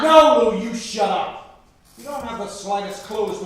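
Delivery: angry yell. Acted. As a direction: throw it back in anger. Man yelling back at her.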